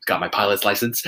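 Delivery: low, soft, halting interview answer; Tom Cruise impression. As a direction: answer modestly, low and hushed, stumbling on I. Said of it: "He's like".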